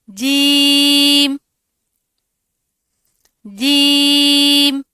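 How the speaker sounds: جیم held very long and slow, loud; drawn-out letter jeem. Shown as a demonstration. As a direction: stretch جیم slowly as a model. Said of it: "زبان کا درمیانی حصہ اوپر کے تالو سے لگے گا تو جیم". (جیم کو لمبا کھینچ کر)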